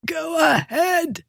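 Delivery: high, slow strangled croak. A narrator's reading. The throttled bully daring the Duke, high and croaking. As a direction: squeeze it out, nearly throttled. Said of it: "the man croaked".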